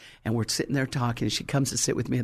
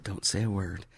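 low, much softer aside; hushed warning; 7 semitones lower, 8 dB quieter than usual. Retold or imitated. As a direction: keep it under your breath to her. Hushing assistant at the desk.

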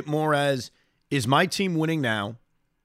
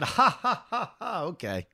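retold chuckle; 4 dB quieter than usual. Radio host retelling his reply to executive's idea, chuckling. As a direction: laugh it off, then trail down. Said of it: "I chuckled and I said".

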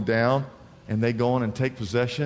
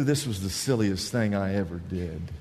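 low, softened soldier's grumble; private grumbling; 8 semitones lower, 4 dB quieter than usual. Acted.

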